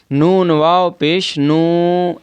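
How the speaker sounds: नून वाव पेश नू with नू stretched long; drawn-out vowel. Shown as a demonstration. Teacher showing students the long नू, drawn out. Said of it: "तो खींच के पढ़ेंगे".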